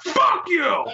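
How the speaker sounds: high belligerent shout; imitated yell. Imitating a ratty man yelling at a small kid, belligerent. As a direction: yell it down at someone, mock-raging. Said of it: "yells down at this kid".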